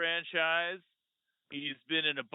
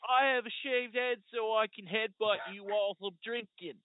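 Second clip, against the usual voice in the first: mock British tough-guy accent, higher pitched; Jason Statham accent; 6 semitones higher than usual. Podcaster joking as a headbutting action star, in mock British accent. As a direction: do a badly faked British hard man. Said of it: "I cannot do accents at all".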